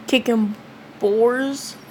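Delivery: usual speaking voice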